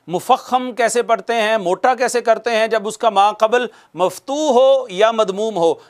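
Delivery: ordinary delivery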